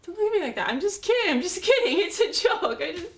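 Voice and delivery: high, loud, panicky plea; desperate, shrill-pitched insistence; frantic backpedalling. Acted. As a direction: scramble to take it all back. Frantically retracting the confession.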